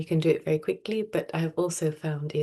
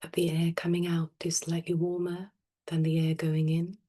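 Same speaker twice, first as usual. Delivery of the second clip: soft, unhurried meditation voice with long pauses; guided meditation; 4 dB quieter than usual. Real, not acted. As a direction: gently guide them into stillness, phrase by phrase. Leads a breathing meditation.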